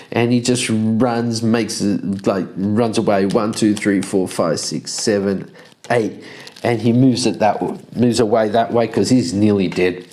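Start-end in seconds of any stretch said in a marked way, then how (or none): none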